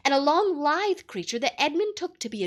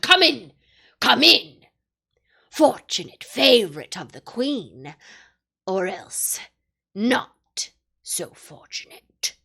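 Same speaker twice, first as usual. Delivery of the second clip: wolf; 2 semitones lower, 9 dB louder, slower than usual. A narrator's reading. Wolf summons Edmund.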